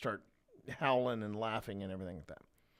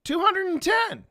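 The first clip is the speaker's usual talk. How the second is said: high, loud, hopeful yell; shouted number; 10 dB louder than usual. Retold or imitated. Kid trying another joke number, high hopeful yell. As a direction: shout it again, louder, into silence. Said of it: "and then he goes".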